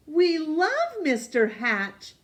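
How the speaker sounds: high, loud and slow insisting neighbors. A narrator's reading. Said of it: "insisted Mister and Missus Dunwoody".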